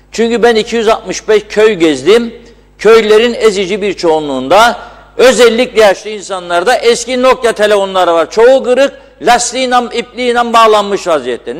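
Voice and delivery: loud, heated outburst; angry rant. Real, not acted. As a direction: shout your frustration at them. Host rants against companies.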